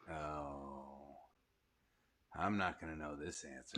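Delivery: low, soft admission of defeat; deflated, hushed and low; resigned. Real, not acted. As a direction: sink down low and quiet, stumped. Host stumped by trivia question.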